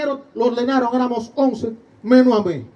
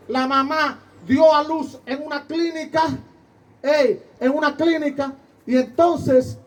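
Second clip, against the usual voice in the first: ordinary talk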